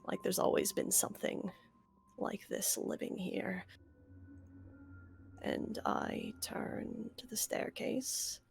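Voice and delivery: whispered, halting, queasy; barely holding down nausea; hushed and shaken. Acted. Player in character, nauseated, hushed.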